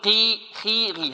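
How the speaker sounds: قي، خي، غي with a clean long i; lightly heavy letters. Shown as a demonstration. Teacher shows qaf with kasra, clean long i. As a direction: say قي، خي، غي without rounding into "qui". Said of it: "وإذا كانت مكسورة نقول".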